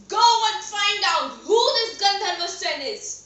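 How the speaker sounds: high, loud royal command; furious king. Acted. King sends the minister to find out, furious. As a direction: order it in an acted fury. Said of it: "The king was furious".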